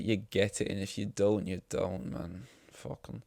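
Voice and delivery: fading muttered dismissal; fading to quiet. Real, not acted. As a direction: let it die away dismissively.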